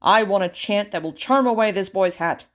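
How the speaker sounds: loud, firm order; royal command. Acted. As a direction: demand it of the magicians. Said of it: "ordered the king".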